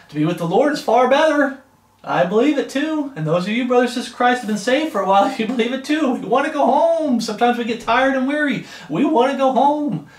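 fervent preaching. Real, not acted. Preacher to fellow believers longing for home, fervent preaching. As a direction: preach it out high and full.

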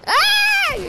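short high-pitched loud yelp; sudden yelp. Real, not acted. Player reacting to a game mishap, startled yelp.

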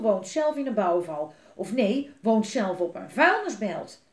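loud angry ranting; furious alderman ranting to his kids. Acted. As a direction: rant about the headlines. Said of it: "Hij was woedend".

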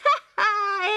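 a kookaburra's laughing call. A narrator's reading. Kookaburra laughs at the trial, high cackle. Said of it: "laughed the kookaburra".

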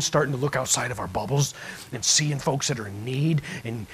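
ordinary talk, nothing marked

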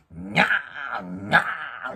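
slow, loud stretched meow; drawn-out imitation meow; cat's meow cries. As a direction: yowl like a cat waking you. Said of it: "で、手でこうしたりする".

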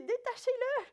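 ordre lancé haut et fort; ordre crié. Acted. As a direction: commande qu'on le libère, à pleine voix. Jésus ordonne devant le tombeau. (Jésus ordonnant à la foule, très fort)

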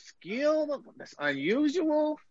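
high, slow disbelieving question; squeaky mock surprise; mock incredulous. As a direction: act baffled that it counts as a skill. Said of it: "I'm like".

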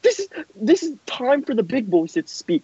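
high, indignant promo scolding; scolding put-down. Acted. Wrestler telling Steve to leave it to big boys, scolding.

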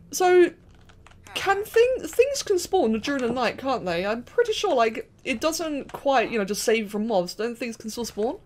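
ordinary talk, nothing marked

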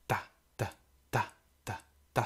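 ta ta ta ta ta beaten out as rhythm; rhythm syllables. Shown as a demonstration. Teacher to class, tapping the rhythm. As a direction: count the beat on ta. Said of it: "the stress is always in the first".

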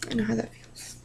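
barely voiced, very soft aside; hushed, moved, almost no voice; real emotional whisper. Real, not acted. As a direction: whisper it as a private confession. Reader moved by story of loss.

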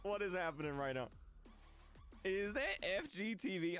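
usual speaking voice

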